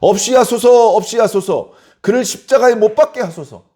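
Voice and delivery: crowd's shouted demand. Acted. Agitated crowd demands a crucifixion from Pilate, shouting. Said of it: "그들은 더욱 소리를 질렀습니다".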